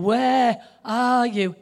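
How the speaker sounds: stretched slowly, each word weighted; slow and drawn out. Shown as a demonstration. Preacher catches himself reading God's question slowly. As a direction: linger over every word. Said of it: "So I even just did it then".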